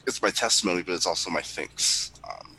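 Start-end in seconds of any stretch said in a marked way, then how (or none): none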